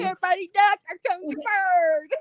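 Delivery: high, loud mock shout of warning; a hammed-up warning cry; mock alarm call. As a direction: holler it like a lookout spotting trouble. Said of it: "It's like".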